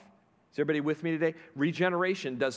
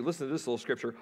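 ordinary talk

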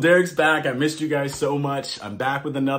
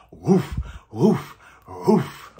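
dog barking; 3 semitones higher, much slower than usual. A narrator's reading. Reading to kids, woofing like his dog. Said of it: "And my dog barks".

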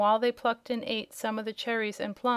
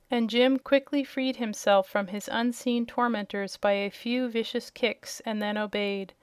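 ordinary delivery, nothing marked

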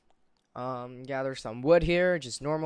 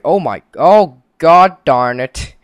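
frustrated outburst; 2 semitones higher, 16 dB louder, slower than usual. Real, not acted. Frustrated at game failure.

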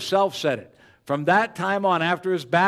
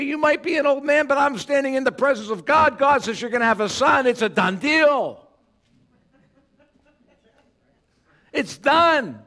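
much higher, louder preaching retort; emphatic Gabriel; 11 semitones higher, 6 dB louder than usual. Retold or imitated. Gabriel answering the doubting Zechariah, loud and high. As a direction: thunder it back at him. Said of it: "Gabriel said".

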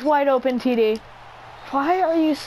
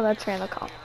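normal talking voice

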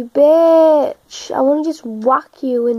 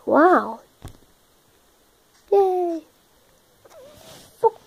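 usual speaking voice

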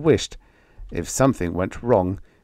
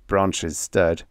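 ordinary delivery, nothing marked

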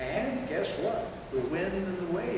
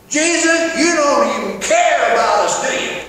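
much louder, higher cry at Jesus; accusing shout; 9 semitones higher, 16 dB louder than usual. Retold or imitated. Disciples shouting at Jesus in the storm, loud accusation. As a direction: yell the complaint in panic. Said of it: "like the disciples and say".

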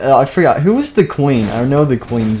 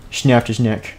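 ordinary delivery

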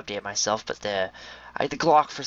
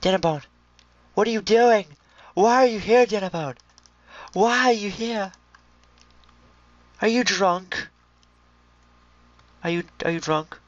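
high, loud mock-worried baby talk; fussing at pig; 9 semitones higher, 4 dB louder than usual. Gamer asks upside-down pig why it's here, high and loud. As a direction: fuss over the pig like a pet.

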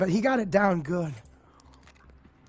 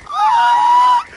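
shrill panicked scream; high scream; 8 dB louder than usual. Real, not acted. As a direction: shriek in a sudden fight. Under fire in a fight.

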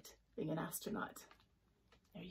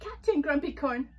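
higher, louder mock title; giggly teasing; 7 semitones higher, 8 dB louder than usual. Jellyfish teases Unicorn, lightly giggly. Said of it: "giggled Jellyfish".

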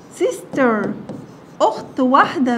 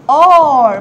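are punched loud; stress on are; 8 dB louder than usual. Shown as a demonstration. Teacher stresses are so pupils hear the sound. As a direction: hit the word are hard so kids hear it. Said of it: "بتك عليها ليه كده يا ولاد؟ عشان تعرفوا فرق النطق".

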